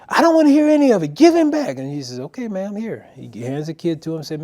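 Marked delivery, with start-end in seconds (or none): 0.1-1.8 fast, high, loud yell cutting him off; screaming mother. Retold or imitated. Mom cutting off the stranger, screaming. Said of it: "she's screaming". 2.2-3.1 higher-pitched, appeasing retold reply; placating. Man handing child back to mom, placating.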